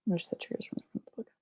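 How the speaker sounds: soft whispered question. Real, not acted. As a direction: whisper it under your breath. Whispered reading of chat.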